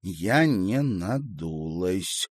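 slow, carefully separated words; distinct, deliberate. A narrator's reading. Girl to an adult, denying she is sulking, distinctly. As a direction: spell it out slowly, syllable by syllable. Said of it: "тихо и отчётливо проговорила девочка".

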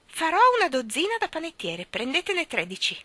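cheerfully. Acted. Old shopkeeper cheerfully gives the children extra. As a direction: offer it gleefully.

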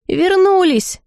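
high-pitched butler's cry of news; yelling. A narrator's reading. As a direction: call it out high, stretching the word. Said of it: "во всё горло завопил дворецкий".

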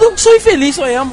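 high, hammed-up mopey whine; mock whine. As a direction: whine it like a sulky emo. Mock self-pity.

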